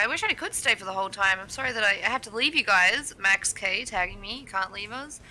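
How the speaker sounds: normal talking voice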